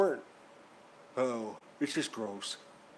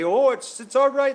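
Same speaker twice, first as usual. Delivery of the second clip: much higher, much louder mock dismissive voice; mocking the French; 11 semitones higher, 14 dB louder than usual. Vlogger mocking French duck farmers, high mock voice. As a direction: lampoon the breezy French farmers. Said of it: "and they're like, the French, eh?".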